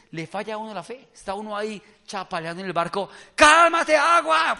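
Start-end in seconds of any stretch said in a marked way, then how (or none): 3.3-4.6 high, very loud, drawn-out yell; hammed-up order to the water; desperate shout. Believer floundering in the boat, shouting at the sea.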